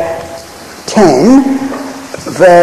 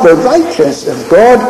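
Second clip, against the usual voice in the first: normal talking voice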